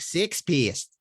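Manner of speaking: put-on Kiwi accent, "Sex pest" as "sɪks pʰist"; New Zealand accent. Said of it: "But they say it in that New Zealand accent".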